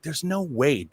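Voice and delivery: ordinary talk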